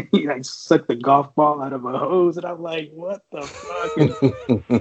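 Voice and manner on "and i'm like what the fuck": stunned disbelief. Retold or imitated. Recruit reacting to absurd claim.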